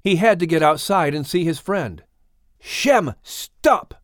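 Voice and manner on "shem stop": sharp call rising high on stop; Noah yelling. A narrator's reading. Noah yelling to stop Shem at the roof hatch. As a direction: cry out to halt him. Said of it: "Noah yelled".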